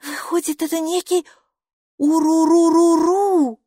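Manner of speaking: high voice echoing a creature's call; Хилла. A narrator's reading. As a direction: conclude in a high voice, drawing out the name loudly. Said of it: "заключила Хилла".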